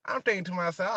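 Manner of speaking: normal talking voice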